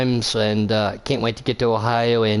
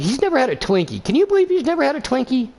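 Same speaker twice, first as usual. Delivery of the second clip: high, fast, incredulous exclamation; can you believe it, rising excitedly; amazed disbelief; 12 semitones higher, faster than usual. Real, not acted. Commentator telling co-host in amazed, high voice. Excited about friend never tasting Twinkie.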